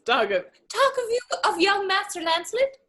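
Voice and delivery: high-pitched disguised voice; comic high voice to fool someone; teasing put-on voice. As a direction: give confusing directions in a high mock-helpful voice. Lancelot questions father in disguise.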